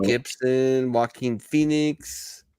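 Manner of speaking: drawn-out slow clue names; hinting slowly to a friend; slow teasing clues. Real, not acted. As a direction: draw out each clue to tease.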